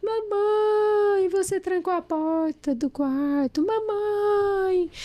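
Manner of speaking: put-on high, loud wailing child calling through the door; crying little boy. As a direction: plead like a small boy shut out, slow and tearful. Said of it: "esse menino lá de fora". (criança chorando alto do lado de fora da porta, voz aguda)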